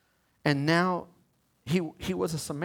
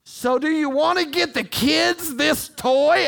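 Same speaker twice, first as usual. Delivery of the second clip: a "whisper" that is high and loud, slowed; mock whisper that is really full voice; wife's loud whisper; 10 semitones higher, 7 dB louder than usual. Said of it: "Her whisper is like this".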